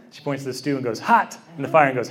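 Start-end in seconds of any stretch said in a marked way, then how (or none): 1.0-1.4 imitating a toddler's high, emphatic one-word cry; mock toddler exclamation, pitched up; intense toddler. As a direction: blurt it like a proud toddler. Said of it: "just in the intensity".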